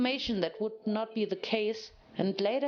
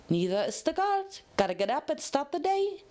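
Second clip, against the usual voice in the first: bubbly Pinkie Pie; 6 semitones higher than usual. A narrator's reading. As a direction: cheer it out briskly. Pinkie cheerful.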